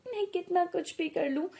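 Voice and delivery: plain reading voice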